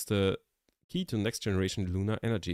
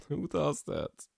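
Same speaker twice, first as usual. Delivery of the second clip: laughing; 5 semitones higher, 3 dB quieter, slower than usual. Real, not acted.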